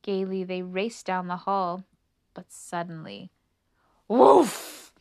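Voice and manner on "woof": loud, sudden dog woof; big angry woof; fierce bark. A narrator's reading. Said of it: "barked a fierce, angry voice".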